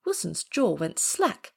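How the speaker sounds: ordinary delivery, nothing marked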